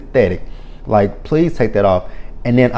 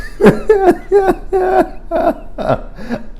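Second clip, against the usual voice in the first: long shrieking laugh; high squealing laugh. Real, not acted. Recapper overcome by the episode, shrieking laugh. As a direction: let a helpless high laugh out. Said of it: "this episode has killed me".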